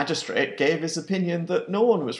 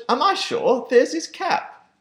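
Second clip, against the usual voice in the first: indignant father; 11 semitones higher, 5 dB louder than usual. Acted. Pa answers the keeper.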